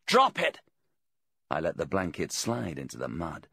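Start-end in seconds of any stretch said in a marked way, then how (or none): 0.1-0.6 high, loud command; demanding. A narrator's reading. Voice from the caravan orders the blanket off, demanding. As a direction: fire it out high and forceful. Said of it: "the voice from the caravan demanded".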